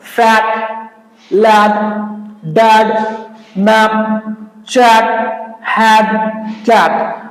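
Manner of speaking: फैट लैड डैड said slowly, one by one; demo words with ae. Shown as a demonstration. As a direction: spell out the ae sound in फैट and कैट. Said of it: "examples". (धीरे धीरे उदाहरण शब्द बोलें)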